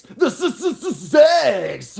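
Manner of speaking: very high stuttered shout. Acted. Streamer yelling the station name in a DJ voice. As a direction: blast the stutter like a hyped DJ. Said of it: "did the read-in".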